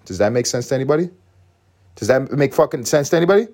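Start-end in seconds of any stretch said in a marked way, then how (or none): 1.9-3.5 louder, higher, sharply annoyed repeat; defensive. Real, not acted. Repeating the question to viewers, now defensive and louder. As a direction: snap the question out at the audience. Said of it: "Sound a little defensive right now".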